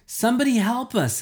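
high desperate cry for help; yelling for help in the alley. A narrator's reading. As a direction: call out for help. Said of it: "I yelled".

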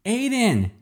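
put-on high call of a child's name; mother calling for her boy; mother's scream. A narrator's reading. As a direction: scream for a lost son. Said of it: "a woman screamed".

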